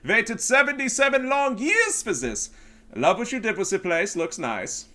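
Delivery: put-on loud, high German accent, drawn out; booming hammed-up German comeback, easing off at the end. Comedian showing off a skit greeting Russia, loud German accent. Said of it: "I do the German voice where I go".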